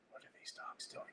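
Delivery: hushed, barely voiced aside; whispered aside. Real, not acted. Streamer breaking off to wonder about the dogs, whispered aside.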